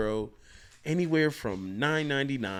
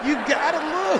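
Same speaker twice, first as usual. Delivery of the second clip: excited; 10 dB louder than usual. Real, not acted. Loud excited echo of punchline.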